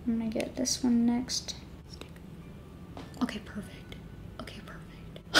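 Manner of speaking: soft whisper while concentrating; hushed focused whisper. Real, not acted. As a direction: whisper it under your breath. Concentrating on placing lashes.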